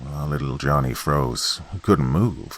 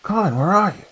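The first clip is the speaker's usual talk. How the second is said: much higher, searching call; 3 dB louder than usual. Acted. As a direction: shout into the cave for a lost brother. Boy calling brother in cave.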